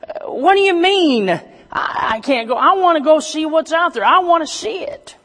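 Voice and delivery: high, loud, rising protest; hammed-up indignant whine, high and loud; upset pleading. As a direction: protest high and hurt, like a let-down boy. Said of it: "he's upset".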